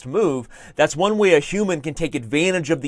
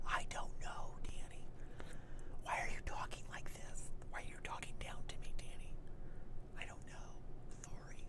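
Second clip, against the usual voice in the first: whispering; 22 dB quieter than usual. Pretend. Whispered back-and-forth continues.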